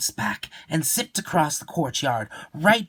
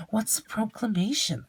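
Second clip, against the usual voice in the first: put-on young boy voice; 10 semitones higher, 3 dB quieter than usual. Boy voice asking.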